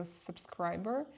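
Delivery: usual speaking voice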